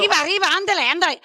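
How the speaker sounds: high, loud cartoon mouse yelp; cartoon catchphrase. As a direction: squeal it like a speedy cartoon mouse.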